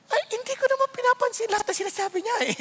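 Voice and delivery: high, fast, breezy brush-off; answering his child about mom; light self-quote. Retold or imitated. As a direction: toss it off lightly. Pastor quoting his answer to child.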